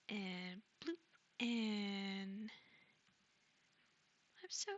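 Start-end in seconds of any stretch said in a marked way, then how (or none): none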